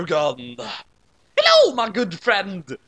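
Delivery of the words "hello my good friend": louder, slower hearty greeting; bellowed. A narrator's reading. Watery greeting Kay with a bellow. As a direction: boom it at your friend. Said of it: "Watery bellowed".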